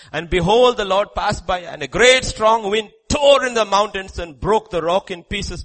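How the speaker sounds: loud, high surges on wind, earthquake and fire; dramatic scripture reading. Real, not acted. Preacher reads of the Lord passing by, dramatic proclamation. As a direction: proclaim the scripture with rising force.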